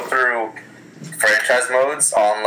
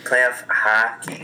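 sexy voice; much slower than usual. Pretend. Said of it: "the sexy voice".

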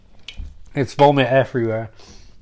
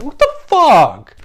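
high, louder, slow shocked cry; startled outburst, drawn out; 10 semitones higher, 7 dB louder, slower than usual. Retold or imitated. Bobby shocked as his date keeps vanishing, high cry.

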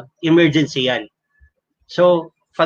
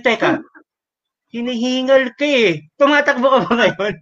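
lightly voiced mother, pitched high; a hint of a probing mom; suspicious mother; 7 semitones higher than usual.

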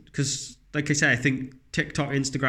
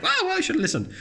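put-on much higher, whiny child voice; little girl whining. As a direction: squeak it out like a hurt little girl. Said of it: "It's like".

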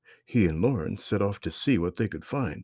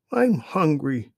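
put-on high, slow, drawn-out kid voice; hungry little girl; 8 semitones higher, 5 dB louder, slower than usual. A narrator's reading. Sister tells brother she's hungry, slow high whine. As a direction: stretch it out like a tired hungry child. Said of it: "said Lauren".

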